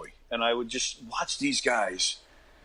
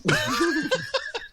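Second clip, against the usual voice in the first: a sudden high laugh; high burst of laughter. Real, not acted. Guest laughing at the host's interview story, a high laugh.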